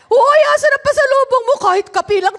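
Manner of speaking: high, loud, pushy call; demanding neighbor. Retold or imitated. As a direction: imitate a neighbor hollering for a gift. Voices neighbors demanding homecoming gifts. (malakas na paniningil ng kapitbahay)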